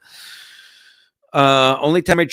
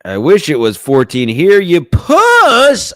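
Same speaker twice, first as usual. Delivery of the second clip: mock whiny shout; 5 semitones higher, 9 dB louder, slower than usual. Mock pleading for pictures.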